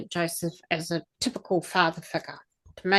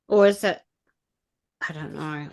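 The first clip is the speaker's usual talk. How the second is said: usual speaking voice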